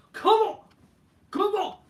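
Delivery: shouted high and loud, hammed up; hammed-up fan shout. Orton imitated whipping up the fans with a hammed-up shout. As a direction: yell it out like a wrestler hyping the crowd. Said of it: "I look so great, like".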